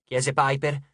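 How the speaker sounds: ordinary delivery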